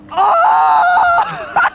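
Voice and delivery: very high shriek of surprise; long high excited "Oh"; amazed scream. Real, not acted. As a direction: scream in astonishment. Reacting to people jumping.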